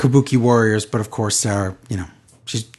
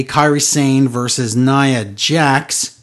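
ordinary delivery

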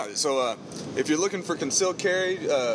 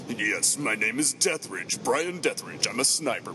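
lower, slow menacing self-introduction; sinister sniper voice; 4 semitones lower, slower than usual. Acted. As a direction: play it like a movie villain.

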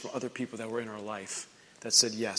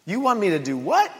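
much higher, playful disbelief; mock startled whoa; 11 semitones higher than usual. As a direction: pull up short in mock shock. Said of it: "it's okay to be like".

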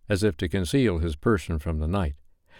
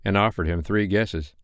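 ordinary delivery

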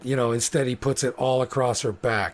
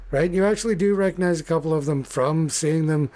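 normal talking voice

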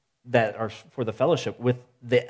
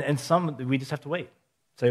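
ordinary delivery

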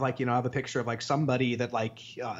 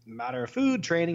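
mock disbelief, pitched much higher; imitating shock; 11 semitones higher than usual. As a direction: imitate a shocked store guy in a high voice. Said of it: "He's, like, so shocked".

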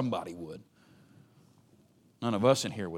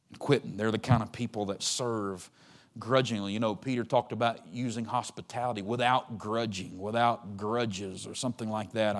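ordinary talk

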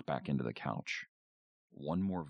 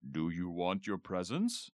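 a hint of a jolly Santa asking, rising on presents; lightly voiced Santa, teasing question; deep Santa voice; 3 semitones higher than usual. A narrator's reading. Dad as Santa coaxes Tina, light Santa voice. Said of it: "In a deep Santa voice".

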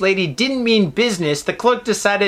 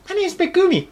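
squeaky high mock girl; high girly voice; 11 semitones higher, faster than usual. Retelling the girl correcting her name, high squeak. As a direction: pitch it way up like a young girl. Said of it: "She goes".

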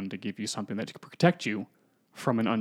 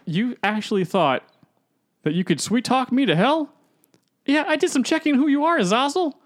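much higher and louder gloating; triumphant taunt; 12 semitones higher, 6 dB louder than usual. Retold or imitated. Hero mocking the demon who tried to trick him, gloating. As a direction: rub it in his face.

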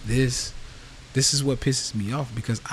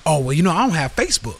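a louder, faster, whiny brush-off; whiny excuse voice; 5 semitones higher, 6 dB louder, faster than usual. Retold or imitated. Follower brushing off the free Facebook group, mock whiny. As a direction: toss off the excuse like it's obvious. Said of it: "excuse list".

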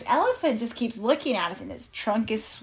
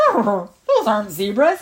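slow, louder animal voice; animal character; 6 dB louder, slower than usual. Acted. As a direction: deny it slowly as a doubtful storybook animal. Frank doubts in story.

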